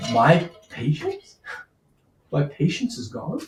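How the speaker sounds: slow, incredulous, simmering repeat; slow disbelief. Acted. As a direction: let the disbelief sink in. Lord repeats in disbelief, slowly.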